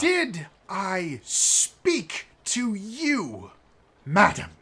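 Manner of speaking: high, slow, scornful challenge; indignant noble. Acted. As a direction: look down on her and sneer the words. Noble snapping at the lady.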